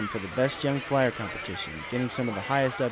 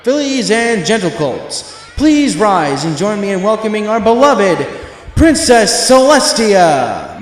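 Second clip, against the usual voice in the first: slow, booming announcer call, higher and much louder; stadium announcer; 8 semitones higher, 12 dB louder, slower than usual. A narrator's reading. Announcer welcomes the crowd, booming and slow. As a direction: boom it out over the amplifier like a showman. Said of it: "the announcer began over the stadium's amplifier system".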